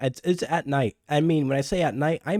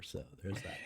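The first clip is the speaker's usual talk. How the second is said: whispered aside; 5 semitones lower, 19 dB quieter than usual. Real, not acted. Aside after table remark.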